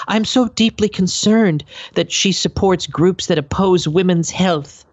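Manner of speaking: high, prissy mock of a leftist senator. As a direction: lift it up high and sound theatrically concerned. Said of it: "That's my leftist voice".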